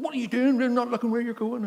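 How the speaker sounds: high, indignant telling-off; squeaky mock rant at the driver; mock angry man. Man with dog scolding the driver, mock angry.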